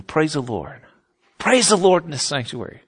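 mock revival reading, swelling line by line; building intensity. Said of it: "I'm gonna build intensity".

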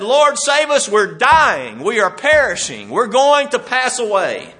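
terrified disciples crying to the Lord; desperate cry. Acted. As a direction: beg for your life over the waves. Disciples wake Jesus in storm.

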